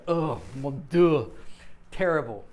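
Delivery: put-on a French listener groaning in a French accent; mock French dismay. Imitating a French speaker horrified by bad pronunciation. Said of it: "everybody who's French will be going".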